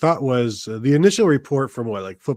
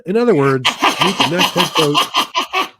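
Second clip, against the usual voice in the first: slow, high, big booming reveal of "meet the next head coach"; dramatic announcement; 6 semitones higher, 6 dB louder, slower than usual. Retold or imitated. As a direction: hype it up like a grand announcement, stretching "head coach". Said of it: "And it said".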